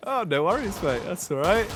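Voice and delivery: usual speaking voice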